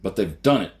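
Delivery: loud booming outburst; bellow. A narrator's reading. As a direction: bellow it at him. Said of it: "Kirk bellowed".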